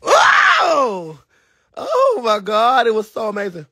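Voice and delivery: high wailing cry, easing off; gushing, high-pitched wail; overcome with delight. Real, not acted. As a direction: cry out high, then draw it out. Reliving an amazing night.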